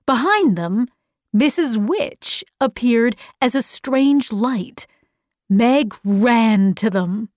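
ordinary delivery

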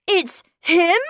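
high, rising alarmed question. A narrator's reading. Miles guessing who is at the window, alarmed. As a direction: ask it in sudden alarm.

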